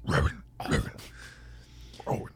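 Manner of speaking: Gollum voice. Retold or imitated. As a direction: repeat the name like a creeping Gollum. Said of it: "sound like Gollum there".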